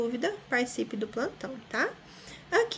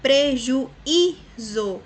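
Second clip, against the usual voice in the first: "Prejuízo" slowly as PRE - JU - Í - ZO, loud beats; stressed syllable shown; 2 semitones higher, 7 dB louder, much slower than usual. Shown as a demonstration. Teacher finds the tonic syllable, stressing Í. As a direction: split "Prejuízo" and lean hard on the Í. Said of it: "a posição da sílaba tônica?".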